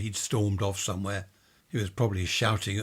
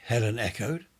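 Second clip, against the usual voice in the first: ordinary delivery, nothing marked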